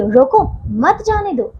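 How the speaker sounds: slow reading, रोको set apart from मत जाने दो; pause after रोको. Shown as a demonstration. Teacher showing pupils how to read the sentence, pause after रोको. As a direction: break after रोको, then stretch out मत जाने दो. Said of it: "इस वाक्य को इस प्रकार पढ़िए".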